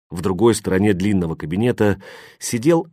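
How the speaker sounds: plain reading voice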